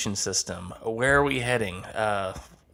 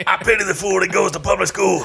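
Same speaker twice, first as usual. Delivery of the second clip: lightly voiced tough guy catchphrase; a hint of Mister T bravado; 6 semitones higher, 4 dB louder than usual. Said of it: "That was pretty good".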